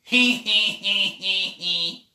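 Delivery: loud, high braying hee-haw; donkey bray. Acted. The donkey answering Pie Weasel with a loud high bray. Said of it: "said the donkey".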